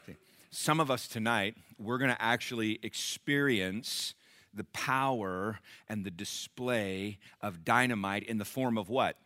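usual speaking voice

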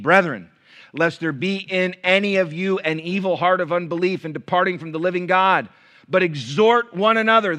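loud, high, driving pulpit voice; impassioned preacher exhorting; full-force preaching. Acted. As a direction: push the verse hard at the church.

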